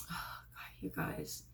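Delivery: real whisper, overcome. Real, not acted. Recalling the woman's face to viewers, choked whisper. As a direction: drop to a hushed whisper. Emotional moment telling viewers.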